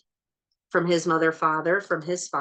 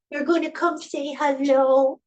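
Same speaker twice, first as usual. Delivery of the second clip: much higher and louder sing-song coaxing; 9 semitones higher, 4 dB louder than usual. Real, not acted. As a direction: call the cat cheerfully. Coaxing the cat.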